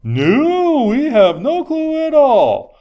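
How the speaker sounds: put-on high, loud, slow sarcastic kid voice; mock the coach with a big high sarcastic drawl. Hockey kid answering the coach, in high loud sarcasm. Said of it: "The kid simply responses was".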